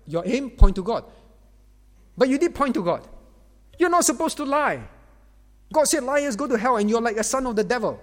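forceful sermon voice rising toward the end; fiery preaching. Acted. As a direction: thunder the warning about lies from the pulpit. Preaching rebuke at full force.

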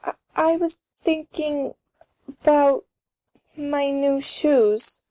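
halting, with pauses, slowing at the end; reluctant confession. Acted. Karen admits her thoughts to grandmother, reluctant. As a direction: stammer and drag out the confession.

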